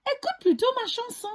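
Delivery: high, fast cheeky galette voice. Acted. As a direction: brush the bear off quickly. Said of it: "dit la galette".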